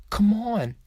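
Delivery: high pleading come on; whining. A narrator's reading. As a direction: beg him to come along. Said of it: "I whine".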